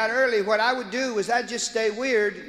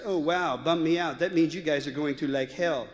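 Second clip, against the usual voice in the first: put-on lower, laid-back valley girl; 6 semitones lower than usual. Daughter telling her friend about hell, valley girl voice. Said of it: "a valley girl".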